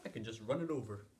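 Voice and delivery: ordinary delivery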